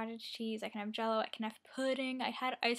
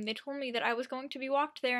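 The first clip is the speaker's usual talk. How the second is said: usual speaking voice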